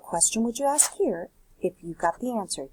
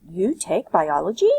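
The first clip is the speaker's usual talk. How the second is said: "You take biology?" louder, rising at the end; question intonation; 7 dB louder than usual. Shown as a demonstration. Teacher showing students a question asked by rising tune. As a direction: let the tune lift on "biology" to make it a question. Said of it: "by doing intonation".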